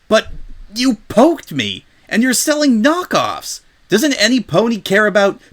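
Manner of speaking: a wronged pegasus pleading her case; Rainbow indignant. Acted. Rainbow protests the knockoffs to the shop owner, indignant.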